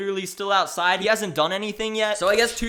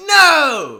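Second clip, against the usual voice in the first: loud cry of no, pitched up; anguished no; 5 semitones higher, 12 dB louder than usual. Retold or imitated. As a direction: call out in despair. Ashley cries out.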